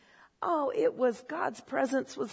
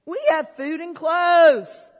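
louder, higher, slow joyful proclamation of We have food and clothes; rejoicing; 5 semitones higher, 11 dB louder, slower than usual. Real, not acted. Preacher to congregation, louder slow rejoicing. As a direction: build it into a shout of praise. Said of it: "great reason to rejoice".

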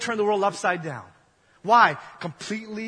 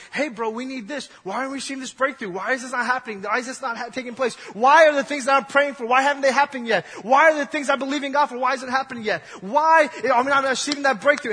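higher, louder pleading whine; whining people; 7 semitones higher, 5 dB louder than usual. Retold or imitated. Preacher voices the people whining at Moses, rising to louder pleading. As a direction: nag and complain, building up. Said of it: "the people are still whining".

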